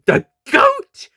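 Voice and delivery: very high, loud squeak; silly squeak. Acted. Doctor listing zodiac animals, silly high squeak. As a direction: squeak it out comically.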